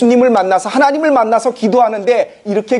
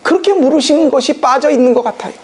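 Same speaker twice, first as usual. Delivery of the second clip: usual speaking voice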